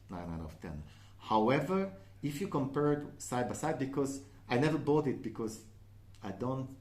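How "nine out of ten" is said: quiet. Real, not acted. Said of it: "I know it's a lot".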